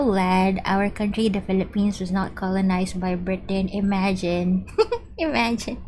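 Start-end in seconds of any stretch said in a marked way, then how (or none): 4.9-5.8 said through a laugh. Real, not acted. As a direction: laugh as you say it. Laughing at her own remark.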